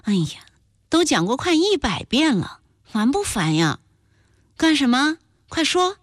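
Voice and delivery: fed up with hearing it from mother; annoyed. A narrator's reading. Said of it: "赵俊生气道".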